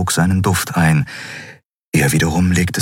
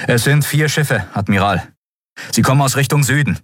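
higher-pitched crew voice; urgent officer's report; 8 semitones higher than usual. A narrator's reading. Communications officer reports ships to admiral, urgent. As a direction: report crisply from the instruments, pitched up.

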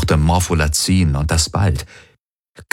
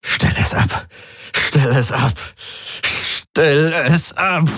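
strained plea climbing much higher; agonised demand; 9 semitones higher, slower than usual. A narrator's reading. Galen begs the Helix as he staggers onward, agonised demand. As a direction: repeat it, building to a drawn-out desperate cry.

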